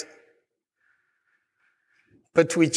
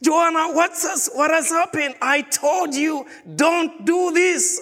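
stern father scolding; 9 semitones higher than usual. Retold or imitated. A father scolds his crying daughter Joanna, high and stern. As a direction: make each word land like a father's warning.

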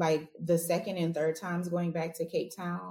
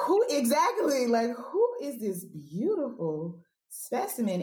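high, swooning disbelief; smitten amazement; 5 semitones higher than usual. Retold or imitated. Woman telling of the man she bumped into, smitten amazement. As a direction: marvel at him, drawing out the words. Retells awe at the handsome stranger.

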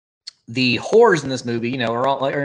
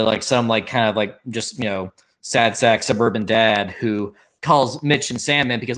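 usual speaking voice

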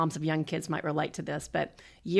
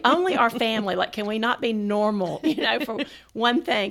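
higher, louder mock-mad complaint; retold exasperation; 5 semitones higher, 5 dB louder than usual. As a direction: retell being fed up with your own family, half laughing. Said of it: "I was so mad".

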